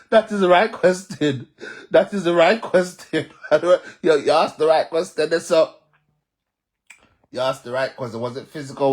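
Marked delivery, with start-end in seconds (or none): 0.1-5.9 laughing loudly. Real, not acted. Laughing over a viewer's question.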